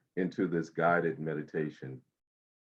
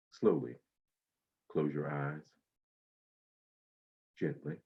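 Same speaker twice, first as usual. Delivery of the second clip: guided meditation. Acted. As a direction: ease them into calm breathing.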